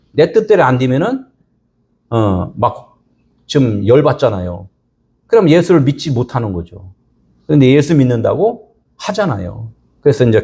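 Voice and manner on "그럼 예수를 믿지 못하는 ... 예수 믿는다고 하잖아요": ordinary delivery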